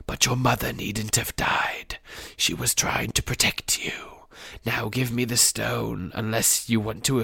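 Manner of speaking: slow, soft, whispered menace; breathy, creepy Voldemort monologue; hissing villain. A narrator's reading. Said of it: "it hissed".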